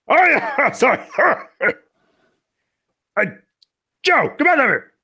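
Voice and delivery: very high, loud squeaky voice with giggles. Acted. Leader calls Joe over in a squeaky giggly voice. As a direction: giggle through it in a squeaky pitch. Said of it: "talking in strange voices".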